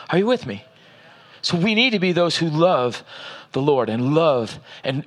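ordinary talk, nothing marked

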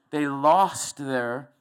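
very slow and deliberate; slowed right down. Shown as a demonstration. Preacher catching himself and slowing for the congregation, very slow. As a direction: drag each word out on purpose. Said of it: "I'm speaking too fast".

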